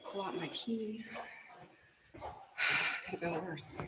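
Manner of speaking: winded after the stairs; panting between words; out of breath. Real, not acted. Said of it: "I'm out of breath".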